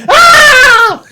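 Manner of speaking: a high yelping shriek cut with laughter; startled laughing shriek. Retold or imitated. Recalling the porn scene, a laughing shriek. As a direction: shriek in shocked amusement.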